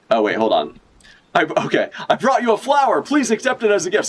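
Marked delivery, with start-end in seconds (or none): 2.1-4.1 high, fawning suitor, rushed; mock lovestruck pirate. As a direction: offer the flower like a smitten pirate.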